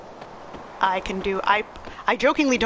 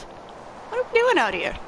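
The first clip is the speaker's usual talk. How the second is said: put-on higher, slower girl's voice; a young heroine, puzzled; her voice; 13 semitones higher, slower than usual. Voicing a female game character, higher and puzzled. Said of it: "That's not how her voice sounds".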